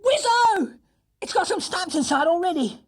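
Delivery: very high, loud schoolboy cheer; thrilled boy. Acted. Jonathan thrilled by gift.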